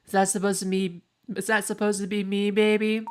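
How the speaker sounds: ordinary delivery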